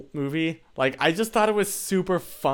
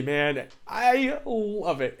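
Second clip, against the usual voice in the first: slow, higher fond drawl; slow gushing; 6 semitones higher, much slower than usual. Real, not acted. Streamer gushing about Megamind, slow and higher. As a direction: savor each word fondly.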